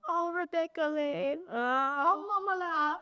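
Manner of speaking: put-on sweet Israeli accent of a doting grandmother, half laughing; grandma's Israeli accent. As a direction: fondly imitate grandma's Israeli-accented voicemail. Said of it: "she leaves me the sweetest messages".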